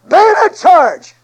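slow, loud, high battle call; barked order. Retold or imitated. Soldier shouting the charge order in the trench, loud and slow.

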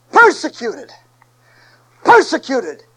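loud ringing preacher refrain; preaching at full force. Acted. Preacher calling the refrain to his congregation, loud and ringing. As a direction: hammer the word out to the church.

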